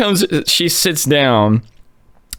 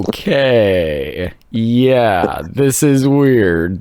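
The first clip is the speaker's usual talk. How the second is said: drawn-out, doubtful okay; wary reaction; much slower than usual. Retold or imitated. Teenagers reacting to a rambling stranger, slow and doubtful. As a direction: stretch it out, mildly weirded out.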